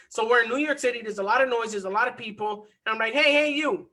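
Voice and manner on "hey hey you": calling out. Retold or imitated. Calling out to stranger in crowd.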